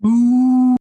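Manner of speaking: loud, long spooky ghost moan; ghostly ooh. Acted. Narrator moans like a ghost to spook the farmer. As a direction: wail it like a ghost. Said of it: "Stupid ghost".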